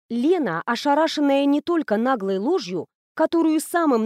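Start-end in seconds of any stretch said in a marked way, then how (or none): none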